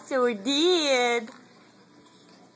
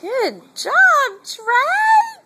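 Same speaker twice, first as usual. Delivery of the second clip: loud, much higher praise; excited cheer; 11 semitones higher, 7 dB louder, much slower than usual. Real, not acted. Mother cheering her baby, loud and high. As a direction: shout him a cheer.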